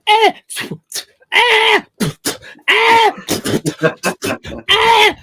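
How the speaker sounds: very high, loud shrill scream; mock screaming women. Friend imitating women in spit fights, mock scream. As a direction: shriek like a panicking woman. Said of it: "waving their arms like".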